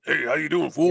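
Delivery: a lightly voiced tough-guy greeting; Mister T voice. Mocking what the impersonator doesn't say offstage, light gruff voice. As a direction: toss off a gruff "fool" greeting. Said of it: "when he's not in character, obviously he doesn't".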